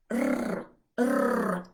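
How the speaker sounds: a long trilled rrr, loud; rolled r. Shown as a demonstration. Teacher demonstrating the Welsh rolled r. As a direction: roll the tongue on rrr. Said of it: "Just say the rrr".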